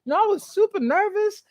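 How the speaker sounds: high, fast mock-relaxed voice; playfully voicing his sister settling in; sister loosening up. Host imitating sister Ebony deciding it's all right, high and quick. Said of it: "She's like".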